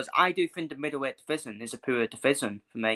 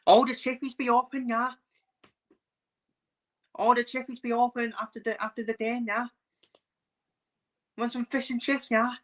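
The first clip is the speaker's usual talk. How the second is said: high-pitched Scouse chippy banter; mock Liverpool accent; 10 semitones higher than usual. Scouse fan asking after chippies, exaggerated high Liverpool accent. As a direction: put on a high, caricatured Scouse voice. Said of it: "I can do the Stealth accent perfectly".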